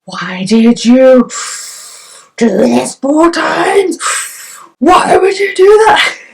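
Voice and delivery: high, slow, loud anguished cry; shouting at mum. Retold or imitated. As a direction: wail it out in pain. Retold labour outburst at mum.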